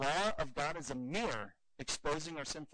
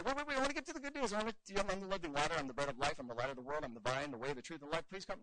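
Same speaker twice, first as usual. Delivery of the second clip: mock pleading Jesus; 2 semitones higher, faster than usual. Imagined Jesus begging the rich man to stay, mock pleading. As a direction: chase after him, pleading in a rush. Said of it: "Jesus did not grab him and say".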